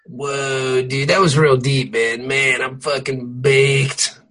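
slow, dazed stoner voice; stoned. Pretend. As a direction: act deeply baked. Acting baked stoner.

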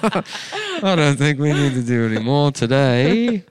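slow, high, loud British caricature; British accent. Acted. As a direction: stretch it out in a silly British accent. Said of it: "the British is like".

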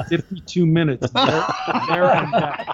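talking through real laughter; laughing. Real, not acted. Driver boasting of his record time, laughing. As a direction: laugh your way through the words.

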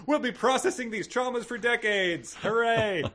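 high-pitched fake celebration; mock cheering. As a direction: cheer hollowly as the traumatized group. Mock cheering of rescued group.

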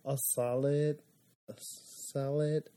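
SOLID softly sounded out as "SAH-lid"; careful repetition. Shown as a demonstration. Copying co-host's SOLID, careful quiet attempt. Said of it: "oh, say it again".

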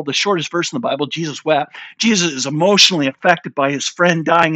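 usual speaking voice

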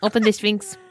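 put-on halting, accented character voice; Latka from Taxi. Mars Boy as Latka ordering the Sphinx opened, accented. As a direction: put on a silly foreign sitcom accent. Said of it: "he's Latka all of a sudden".

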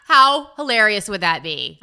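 laughing exclamation. Real, not acted. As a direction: exclaim it straight out of a big laugh. Exclaims straight after bursting out laughing.

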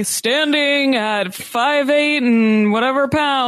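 slow, drawn-out announcer intro; ring announcer voice. Retold or imitated. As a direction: announce the fighter's stats slowly. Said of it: "mock wrestling announcer intro".

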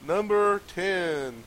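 slow, drawn-out chart countdown; countdown announcer. Acted.

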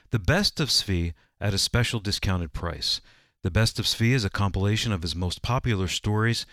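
ordinary talk, nothing marked

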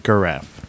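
GIRAFFE mispronounced "guh-raff"; hard-g giraffe. Shown as a demonstration. Showing what it's not, GIRAFFE as "guh-raff". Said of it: "It's not giraffe".

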